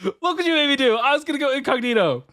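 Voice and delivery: high-pitched comic exasperated yell; embarrassed streamer's high comic rant; frustrated outburst. Real, not acted. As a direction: blame the raider in high, mock-furious cries. Slipped secret on stream.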